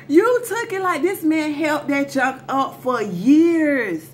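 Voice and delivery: high, loud accusing burst easing down slowly; outraged accusation. Acted. Teller exposing the man's years-long lie, outraged high accusation. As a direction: start high and loud, then stretch it out.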